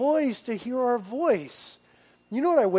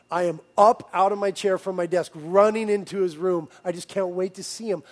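ordinary talk, nothing marked

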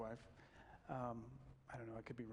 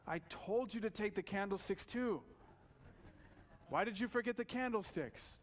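higher, louder playful reproach; mock scolding; 8 semitones higher, 4 dB louder than usual. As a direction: scold him with a wink. Bishop feigns scolding, forgives thief.

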